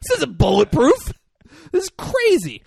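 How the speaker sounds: high, loud hammed-up panic; freaking out. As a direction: wail it in mock alarm. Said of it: "are freaking out".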